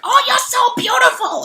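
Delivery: very high flattery; high beetle flattering her; fawning beetle. Acted. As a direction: gush over her, way up high. Beetle voiced.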